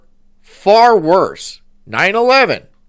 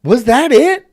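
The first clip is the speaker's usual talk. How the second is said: incredulous; 9 semitones higher, 6 dB louder than usual. Real, not acted. Incredulous follow-up.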